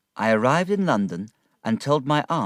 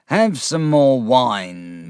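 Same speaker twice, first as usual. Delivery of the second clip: slow coaxing Uriah; 4 semitones higher, 7 dB louder, much slower than usual. A narrator's reading. Uriah plying Wickfield with wine.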